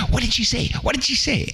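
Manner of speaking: put-on high, eager kids' voices; children clamoring. A narrator's reading. Orphans eagerly pestering the littlest orphan for the secret. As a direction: pester him like excited children.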